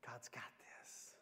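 hushed, slow whisper; whispered assurance. Acted. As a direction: whisper it slowly, as quiet trust. Quiet trust against being an Eeyore.

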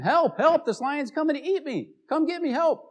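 high, loud panicked cries for help; screaming for help. Retold or imitated. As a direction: scream it like you're about to be eaten. Monkey screaming for help at lion.

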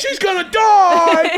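very high screamed outrage; shrieking stand-up rant; Sam Kinison. Acted. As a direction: scream it in disbelief, stretched out. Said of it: "Sam Kinison".

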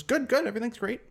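high-pitched, put-on all's-well answer; high little voice covering up; acted passenger's reply. Nervous passenger answers pilot in joke.

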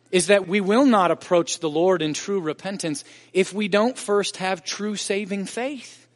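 ordinary delivery

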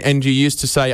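ordinary delivery

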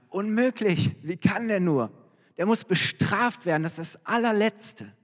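David condemning the rich man without knowing it is himself, indignant; outraged king. Acted. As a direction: burst out in righteous anger at the injustice.

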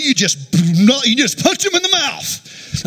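stretched-out, rising brotherly advice; brother's urging. Retold or imitated. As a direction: egg him on, drawing it out high.

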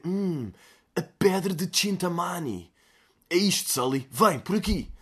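lightly voiced adventure-game hero; Uncharted hero line. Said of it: "sou bué da boa a dizer". (herói de Uncharted, imitação leve)

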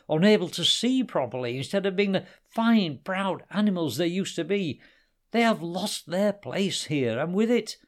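high, loud angry leopard; furious accusation. A narrator's reading. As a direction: accuse him with building rage.

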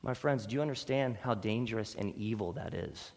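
usual speaking voice

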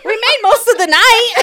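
high, loud laughing delivery; amused shout through laughter. Real, not acted. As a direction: crack up while saying it. Joking while everyone laughs.

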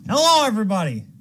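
excited greeting. Acted. Greeting after intro.

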